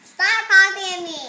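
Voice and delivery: loud scolding. Real, not acted. Kid yells at the copycat bird, loudly scolding. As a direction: yell it at the bird.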